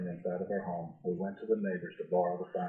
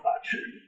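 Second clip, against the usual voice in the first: a big breathy mock sneeze; dragon sneeze. Dragon sneezes after the pepper, a big mock sneeze. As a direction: sneeze it out like a dragon with a tickling nose. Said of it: "he blew a great sneeze".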